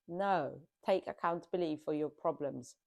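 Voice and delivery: ordinary talk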